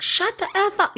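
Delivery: high, mocking snap of a retort; mock rude retort. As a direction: toss it off like a rude stranger. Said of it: "They'll be like".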